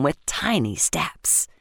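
whispered narration. A narrator's reading. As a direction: hush as he tiptoes home. Narrator sneaks mouse away.

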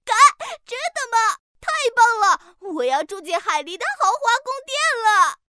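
delighted. Acted. Duck delighted.

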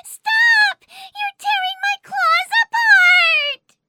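loud pained yelling; pained crying out. Acted. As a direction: cry out in pain. Crab in pain crying out.

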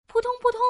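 high, bouncy plops; plopping sound effect. Acted. Storyteller dropping noodles into the pot, high plops. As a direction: plop twice in a high voice.

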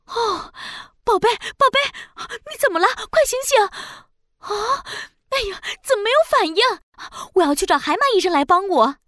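panicked mother. Acted. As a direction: cry out in panic for your child. Mother finds daughter unconscious. (惊慌失措的妈妈)

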